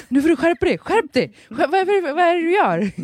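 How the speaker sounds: high, laughing mock scolding; stressed husband. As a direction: imitate a panicked partner through laughter.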